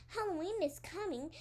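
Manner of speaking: plain reading voice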